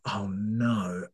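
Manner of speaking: soft breathless "oh no" of realization; whispered dread. Retold or imitated. Parent realizing the toddler had no nappy on, whispered dismay. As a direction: whisper it as the penny drops. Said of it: "I thought".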